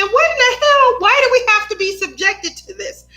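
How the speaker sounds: laughing fake horror; mock outraged disbelief. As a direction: act appalled at the sight. Kid reacting to dad's pants falling.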